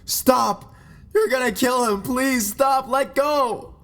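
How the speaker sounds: loud, high-pitched desperate scream. A narrator's reading. Girlfriend begging boyfriend to stop attacking her lover, screaming. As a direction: scream and plead at the top of the voice. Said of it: "and she was screaming".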